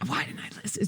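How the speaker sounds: breathy whisper of regret; a hushed self-reproach. Retold or imitated. Regretful inner thought.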